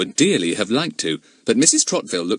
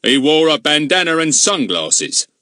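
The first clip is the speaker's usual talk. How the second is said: annoyed; 6 semitones higher, 5 dB louder, slower than usual. Acted. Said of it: "beginning to feel annoyed".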